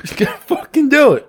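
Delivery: high, drawn-out egging on; pushy high urging. Real, not acted. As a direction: goad him, slowly and high. Urging friend to pick nose.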